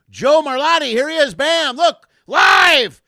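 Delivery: high, shouted big introduction; guest announcer. Real, not acted. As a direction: bring on the guest at full volume.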